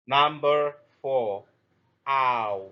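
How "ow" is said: "ow" drawn out, gliding from open to rounded; teacher modelling the ow diphthong; long ow sound. Shown as a demonstration. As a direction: stretch "ow" so both parts are heard. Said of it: "long sound".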